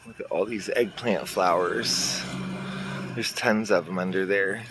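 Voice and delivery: ordinary talk, nothing marked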